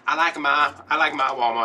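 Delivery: loud, insistent mom quote; imitated stubborn insistence. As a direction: insist loudly, defending your favorite. Mom retold defending her store.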